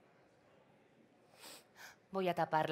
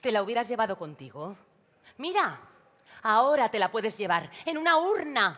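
angry taunt; 3 semitones higher, 10 dB louder than usual. Acted. María taunts her sister about the urn, shouting angrily. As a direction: spit out the jab with rising fury. Cruel jab during sisters' quarrel.

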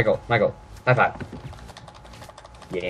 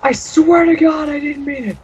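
shrieking falsetto. Acted. Panicked after shooting in game.

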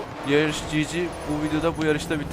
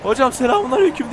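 mock greeting; 7 semitones higher, 5 dB louder than usual. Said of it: "deyip makasa giriyordum".

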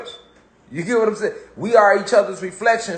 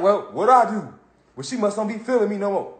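soft, puzzled mock moping; dejected self-talk; 4 dB quieter than usual. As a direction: mumble it like a rejected teen. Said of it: "You like".

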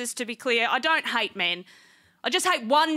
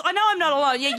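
ordinary delivery

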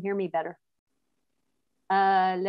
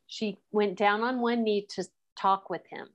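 usual speaking voice

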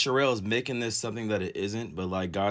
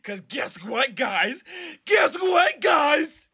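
much higher, louder hype yell; shouting the news to fans; excited shout; 5 dB louder, much slower than usual. Real, not acted. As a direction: scream it like breaking news. Hyping viewers about response video.

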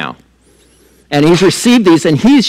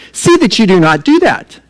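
mildly formal quoted translation, higher pitched; kind of fancy; 6 semitones higher than usual. Acted. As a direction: quote it a touch grandly, pitched up. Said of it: "he says it kind of fancy".

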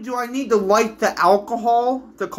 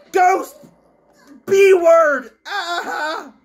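angry scream; 6 semitones higher, 4 dB louder, much slower than usual. Real, not acted. Screaming at ghost.

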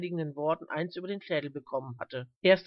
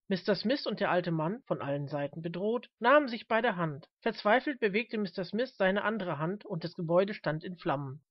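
plain reading voice

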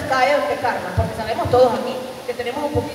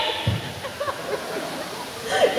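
airy wheezing laugh, softer; high breathless giggle; 12 semitones higher, 8 dB quieter than usual. Acted. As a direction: snicker under your breath. Eric laughing at red man. (risita aguda y sin voz)

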